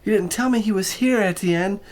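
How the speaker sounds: put-on high, charmed young woman; delighted. A narrator's reading. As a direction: gush over the cat. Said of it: "in a voice ringing with delight".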